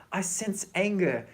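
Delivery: lightly voiced, drawn-out empath line; Deanna Troy. Fan quoting Deanna Troy on the show, slow lightly put-on voice. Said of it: "Deanna Troy's gonna say".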